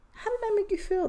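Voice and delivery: high emphatic repeat. Real, not acted. Podcaster pressing listeners, pitched high. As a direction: ask it again, way up high.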